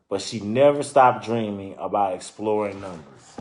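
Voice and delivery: lively storybook narration; reading to children. Acted. Reading a children's book aloud to listeners, lively storytelling. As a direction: read it out like a picture book for kids.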